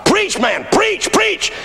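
high-pitched cheering call; imitated deacons' yell. Deacons in the front row urge on preacher, imitated yell. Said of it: "They yell".

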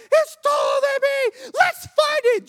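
put-on loud, high excited kid's yell; retold shout.

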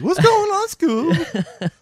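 very high, loud cracking Shaggy voice; squeaky Shaggy impression. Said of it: "you're like, uh, Shaggy".

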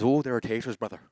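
light imitation of an Irish accent. Pastor imitating Irish colleague, light Irish accent. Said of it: "and he's Irish".

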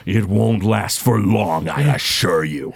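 slow, low, sinister villain voice; a warlock promising doom, unhurried; menacing warlock. Acted. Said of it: "the Warlock Groom goes".

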